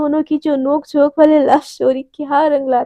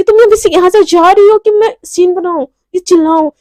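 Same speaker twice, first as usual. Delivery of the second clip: louder, raised angry demand; angry threat; 4 semitones higher, 6 dB louder than usual. Acted. Devika threatening Neha with making a scene, angry. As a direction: threaten her with rising force.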